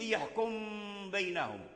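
"يحكم بينهم" with the M held as a hum before B; ghunnah example. Shown as a demonstration. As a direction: linger on the final M of "يحكم", then "بينهم". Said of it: "مثل".

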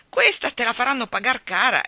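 put-on alarmed boy's outcry; exclaimed warning. A narrator's reading. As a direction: blurt the warning out. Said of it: "esclamò Tom".

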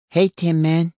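put-on high cheeky child voice; mischievous boy voice. A narrator's reading. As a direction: tease him like a cheeky little boy. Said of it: "the boy said".

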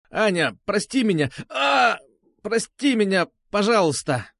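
high-pitched forced apology, broken by a yelp; cried out. A narrator's reading. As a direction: cry the apology out in pain. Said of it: "выкрикнул Бубен". (выкрикнуть извинение от боли)

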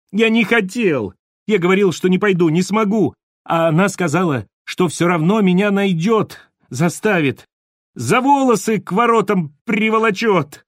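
words tumbling out in a fit; hysterical cry. A narrator's reading. Terrified man blurting out the demon's threats, high and hysterical. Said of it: "припадочно закричал тот".

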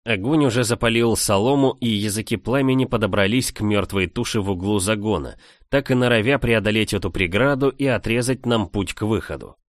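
plain reading voice

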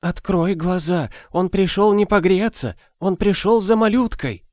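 put-on old woman warning in despair; desperate old woman. A narrator's reading. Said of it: "сокрушалась добрая старуха". (отчаянная старуха)

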